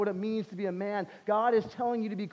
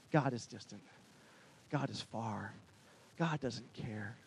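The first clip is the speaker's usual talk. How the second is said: low, soft, resigned statements; bleak doubt; 10 semitones lower, 12 dB quieter than usual. Retold or imitated. Doubting voices telling Moses that God is distant, bleak and hushed. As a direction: let each short line sink low, with pauses.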